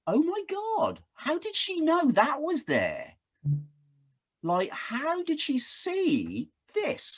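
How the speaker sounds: amazed. Retold or imitated. Dad seeing his daughter's photographs, high with amazement. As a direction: gasp at the photos in wonder. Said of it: "it's like".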